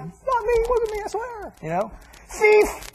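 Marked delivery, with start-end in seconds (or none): 0.0-1.5 very high, squealed mock protest; panicked denial. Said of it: "You'd be like". 2.3-2.9 high, sudden accusing shout; shouted accusation. Acted. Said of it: "like a slanderous word".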